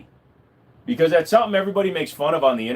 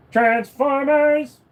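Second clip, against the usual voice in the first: announcer shout; 13 semitones higher, 4 dB louder, much slower than usual. Acted. Calling out the cartoon title.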